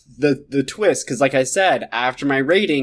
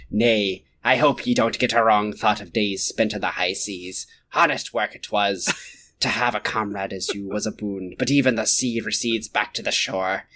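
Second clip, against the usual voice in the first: low, earnest seafaring pirate; old pirate; 6 semitones lower, 3 dB quieter than usual. Acted. Reading their pirate reply to friends, earnest old pirate. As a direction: answer as a hurt old sailor.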